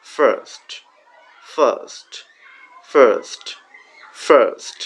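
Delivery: ফার্স্ট with a long pressed ur, slow and clear; teacher models ফার্স্ট for the class to repeat; model pronunciation. Shown as a demonstration. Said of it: "এটার উচ্চারণটা কেমন হবে?".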